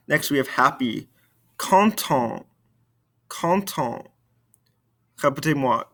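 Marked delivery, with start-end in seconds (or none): 1.6-4.1 "Content" ending on the nasal vowel; no final T in "Content"; silent ending consonants. Shown as a demonstration. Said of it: "these ending consonants".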